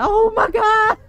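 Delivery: high, loud panicked cry; startled scream. Real, not acted. Player mid-plea to stick together, startled scream. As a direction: shriek as the monster appears.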